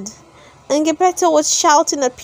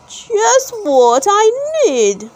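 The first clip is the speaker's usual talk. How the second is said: high, slow exclamation of delight; an old toymaker seizing his chance; eager old man, voice lifted high; old man's cry; 8 semitones higher, slower than usual. A narrator's reading. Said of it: "cried the old man".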